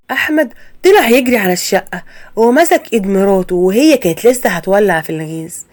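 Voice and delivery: plain reading voice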